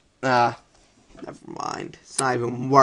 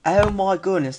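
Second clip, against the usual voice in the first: higher, lightly Australian wildlife host; mock Australian accent; 4 semitones higher than usual. Player marvelling at a giant rabbit, mock Australian accent. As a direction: put on a hint of Australian amazement.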